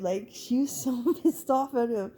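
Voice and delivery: laughing. Real, not acted. Laughing while telling the story.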